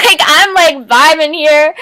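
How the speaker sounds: high, loud, laughing through it; laughing playfully. Real, not acted. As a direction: giggle while insisting you're vibing. Laughing after joke outburst.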